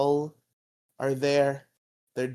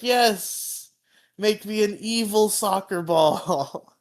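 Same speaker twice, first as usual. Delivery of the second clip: higher, louder, hammed-up villain queen; 9 semitones higher, 5 dB louder, slower than usual. Rita ordering an evil soccer ball, hammed-up villain. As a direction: demand it like a cartoon witch queen. Rita voiced in cartoon retelling.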